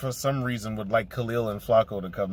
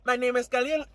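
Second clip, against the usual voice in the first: high put-on character voice for Khalil; hammed-up high character voice; 13 semitones higher, 3 dB louder than usual. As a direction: slip into the Khalil voice. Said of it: "I can't do it".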